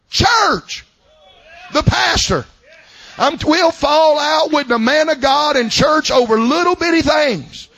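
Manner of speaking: high, ringing sermon voice; preaching at full force. Acted. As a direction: climb back into full preaching. Preaching rises again.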